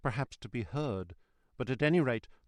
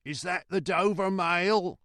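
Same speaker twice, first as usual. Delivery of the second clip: high, slow drawn-out hail; put-on voice calling across the mist; calling from afar; 7 semitones higher, 4 dB louder than usual. A narrator's reading. Rider to the mail coach, long high call. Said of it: "a man's voice called from the mist".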